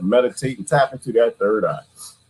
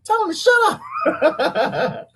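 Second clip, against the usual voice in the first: high squeaky mock complaint; high mock complaint from an annoyed viewer; slower than usual. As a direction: play an irritated viewer, high and laughing. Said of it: "Somebody is pissed off, like".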